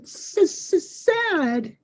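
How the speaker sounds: drawn-out stutter on the first sound; stammered. A narrator's reading. Upset small grandson answers Grammy, stammering. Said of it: "He stammered 'cause he had such big emotional intensity".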